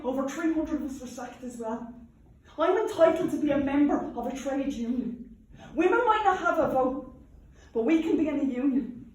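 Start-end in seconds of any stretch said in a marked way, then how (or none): 2.6-9.1 raised voice, loud and firm; defiant declaration. Acted. Union woman insists on her right to membership, defiant and loud. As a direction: proclaim it like a union rally speech.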